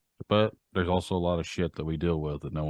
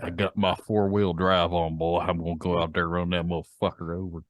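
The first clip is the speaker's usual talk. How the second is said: put-on Southern accent. Driver threatening to run someone over, mock Southern accent. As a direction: play a Southern good ol' boy. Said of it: "we need a southern accent".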